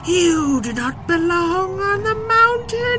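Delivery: very high, slow, howling shout; a roaring mountain wind spirit; booming wind voice. Acted. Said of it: "the wind shouted back".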